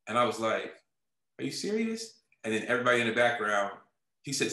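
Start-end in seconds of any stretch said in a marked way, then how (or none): 1.4-2.2 "are you serious" jumping up high in pitch; stunned, can't believe the offer; disbelieving. Retold or imitated. Reacting to the offer to become a director, stunned.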